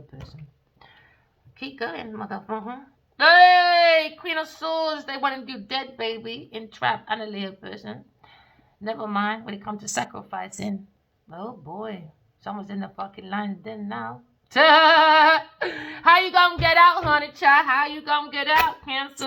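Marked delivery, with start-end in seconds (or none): 3.2-6.0 loud, high call out; loud hey; sudden shout. Acted. Sudden call to the tarot card. 14.5-19.3 slow, loud, high laughing taunt; drawn-out sing-song mockery; mocking taunt. Taunting the signs.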